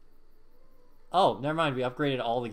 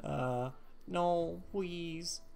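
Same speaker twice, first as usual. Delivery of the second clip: put-on soft, higher baby-talk plea; mock pleading; 3 semitones higher, 8 dB quieter, much slower than usual. Player keeps up the joke begging, soft babyish plea. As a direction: beg feebly in a cutesy little voice.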